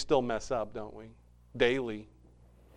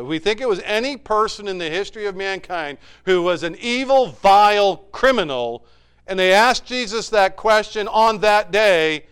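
louder, higher, faster pulpit delivery; preaching at full force; 6 semitones higher, 11 dB louder than usual. Acted. Pastor challenging the congregation, impassioned. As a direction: press the point hard.

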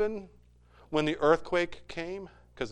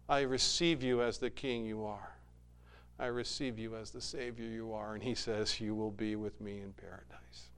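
softer, a humble murmured prayer; quiet prayer; 4 semitones lower, 9 dB quieter than usual. Acted. Believer praying to Jesus at the cross, hushed. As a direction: pray it softly.

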